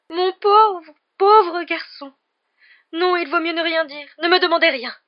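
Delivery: high, loud, theatrical horror; horrified seer. Acted. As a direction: wail in dread at a terrible vision. Seer in horror at what she saw.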